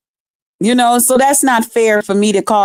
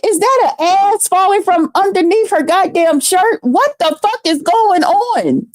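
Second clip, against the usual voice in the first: pitched-up, disbelieving retold gasp at the sight; mock shock; 7 semitones higher than usual. Telling viewers how she reacted to the tall woman, mock shocked. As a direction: act out stunned surprise, voice up high. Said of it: "I said".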